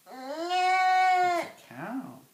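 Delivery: sing-song. Real, not acted. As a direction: stretch it out high and delighted. Parent reading animal book to baby.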